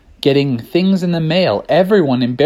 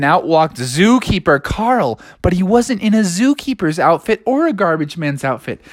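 ordinary delivery, nothing marked

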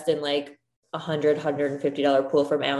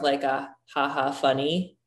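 ordinary talk

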